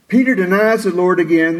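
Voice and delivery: ordinary talk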